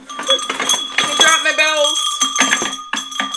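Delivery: drawn-out dismayed wail; mock dismay. Real, not acted. To viewers after dropping jingle bells, slow dismayed wail. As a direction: stretch it out, half laughing at the mess.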